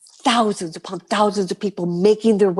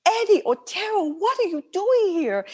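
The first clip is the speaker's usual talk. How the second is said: surprised greeting; 11 semitones higher than usual. Retold or imitated. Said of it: "I'm like".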